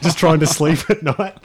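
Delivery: ordinary talk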